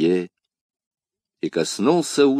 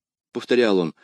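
ordinary delivery

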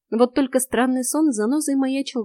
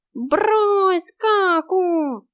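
much higher, whiny cat cry; cat yelling; 10 semitones higher, 3 dB louder, much slower than usual. A narrator's reading. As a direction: yell from the corridor in cat voice. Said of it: "крикнул из коридора котяра".

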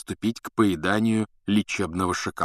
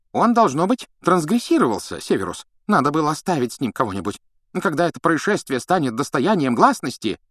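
high, hurried agitated minister; agitated official; 7 semitones higher, 4 dB louder than usual. Acted. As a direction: fuss anxiously. Fudge agitated. (взволнованный чиновник)

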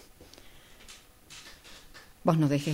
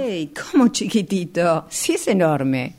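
louder and slower, outraged that anyone calls it small; indignant disbelief; 6 dB louder, slower than usual. Acted. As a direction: protest hard, slowing down. She insists the tank was huge. (sorprendida e indignada, más fuerte y lenta)

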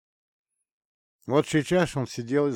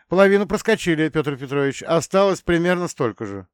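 high, loud, fast driver's report; cheerful quick update; brisk report; 6 semitones higher, 7 dB louder than usual. Acted.

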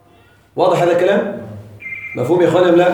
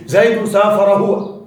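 slow, careful reading of زيد سافر هو; slow example reading; slower than usual. Shown as a demonstration. Teacher showing students the allowed form, slow and careful. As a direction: spell out the example slowly. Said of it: "ممكن".

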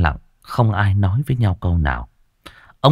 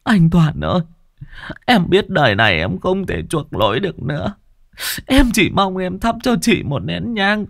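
high, tearful pleading; begging; 11 semitones higher than usual. A narrator's reading. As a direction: beg on your knees, voice high. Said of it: "quỳ xuống van xin". (giọng van xin nghẹn ngào)

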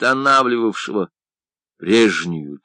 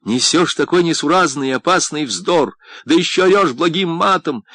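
higher, louder cross rebuke; angry scolding; 7 semitones higher, 4 dB louder than usual. A narrator's reading. Friend rebukes the painter for yelling nonsense, angrily. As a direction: scold him sharply with raised pitch. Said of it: "сердито прервал его Агустин".

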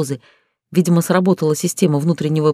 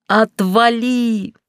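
high, drawn-out cry; yelling; 6 semitones higher, much slower than usual. A narrator's reading. Injured heroine yells at the director to back off, high yell. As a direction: stretch it out in a high-pitched yell. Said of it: "заорала я".

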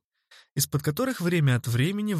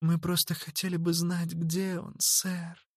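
put-on pleading girl voice; 5 semitones higher, 5 dB quieter than usual. A narrator's reading. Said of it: "сказала Зерлина".

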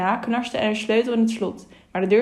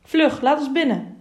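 higher, urgent call; higher-pitched narrated call through a door; 6 semitones higher than usual. A narrator's reading. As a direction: press urgently to be let in. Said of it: "riep een stem aan de andere kant".

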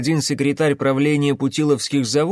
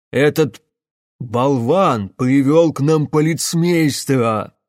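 slow, louder furious hiss; 5 dB louder, slower than usual. Acted. Карпас злобно шипит на Гунькина, медленно и громче. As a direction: spit the insult with fury. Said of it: "прошипел Карпас".

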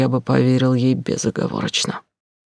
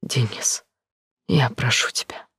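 quiet, begging whisper; pleading softly. A narrator's reading. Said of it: "умоляюще проговорила".

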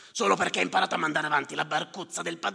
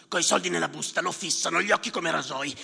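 usual speaking voice

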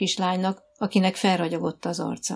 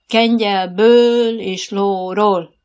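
slow, loud drawn-out order; shouted command; 8 dB louder, much slower than usual. A narrator's reading. Riding coach calling the girl to dismount, slow loud call. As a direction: call each word out across the field. Coach calls from beside the track.

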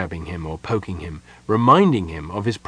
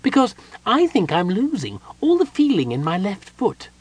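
high, plaintive little character voice; complaining kender; 10 semitones higher than usual. A narrator's reading. Kender complains about numb foot.

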